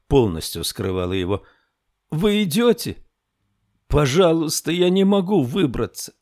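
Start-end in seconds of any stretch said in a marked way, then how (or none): 2.1-6.1 put-on high child's voice, begging; lost boy pleading. A narrator's reading. Lost boy begs for help.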